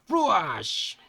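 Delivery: high explosive whoosh; comic-book attack noise; slash sound effect. Acted. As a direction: voice a sword hitting home. Imitated sword attack noise.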